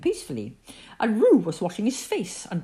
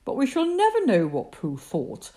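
usual speaking voice

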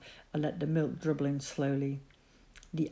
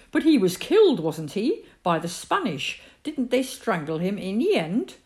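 high, louder challenging question; doubtful mother; 5 semitones higher, 5 dB louder than usual. A narrator's reading. Mother reminds the boy the king was killed, doubtful. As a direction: press him with the grim fact.

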